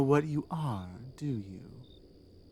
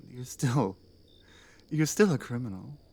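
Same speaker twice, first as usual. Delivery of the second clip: ordinary talk, nothing marked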